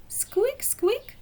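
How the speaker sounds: very high rising squeak; tiny mice squeaking high; mouse squeak. Acted. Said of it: "they cried".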